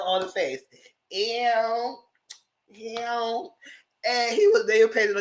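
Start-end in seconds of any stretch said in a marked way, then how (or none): 1.1-3.5 high drawn-out "ew"; imitated stripper squeal. Stripper on her face, mock squealed ew. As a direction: stretch each word into a high little whine.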